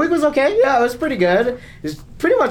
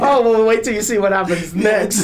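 higher mock-smug taunt, laughing; playful, louder-edged gloating; jealousy-baiting tease; 5 semitones higher, 3 dB louder than usual. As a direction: tease friends who are behind, through a laugh. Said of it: "To make them jealous".

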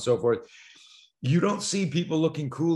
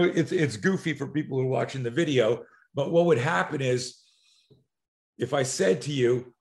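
ordinary talk, nothing marked

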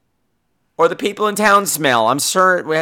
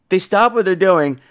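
ordinary delivery

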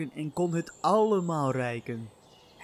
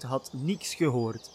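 ordinary delivery, nothing marked

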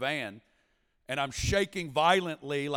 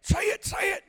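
high frantic shout, much higher; screaming. Retold or imitated. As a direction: yell it right into his ear. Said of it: "He's in my ear screaming".

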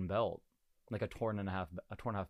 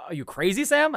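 incredulous rising quote, pitch leaping up high on the name Sam; playful imitation of people asking if he's mad; mock disbelief; 7 semitones higher, 6 dB louder than usual. Riders asking Sam if he's crazy, mock disbelief.

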